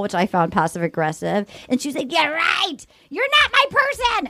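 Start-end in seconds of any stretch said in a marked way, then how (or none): 2.1-4.3 high-pitched mock indignation; indignant retort. Girlfriend throwing the breakup back at him, high-pitched mock indignation. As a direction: imitate a scornful jilted girlfriend.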